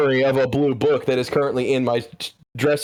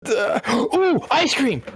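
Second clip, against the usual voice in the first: excited; 9 semitones higher than usual. Real, not acted. Sudden delight at ice cream.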